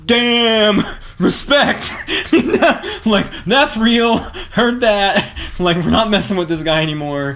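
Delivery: high, loud impressed bar crowd; mock the awed onlookers with a laugh. Voicing the bar crowd after the Marine cried, high awed exclamation. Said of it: "And everyone is just like".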